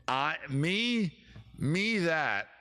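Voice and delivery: usual speaking voice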